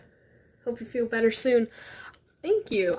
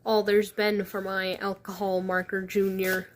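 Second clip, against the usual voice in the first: ordinary delivery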